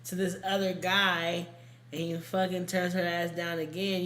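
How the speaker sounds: ordinary talk, nothing marked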